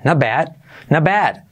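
ordinary talk, nothing marked

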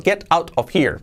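careful t's in "Get out"; well-pronounced, unlinked. Shown as a demonstration. Teacher shows it correctly pronounced, careful "Get out of here". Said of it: "Bien pronunciado".

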